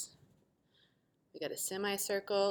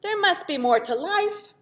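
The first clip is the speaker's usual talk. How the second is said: high, loud longing plea; whiny plea; 12 semitones higher, 13 dB louder than usual. Acted. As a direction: beg for something more. Quadrilateral's plea.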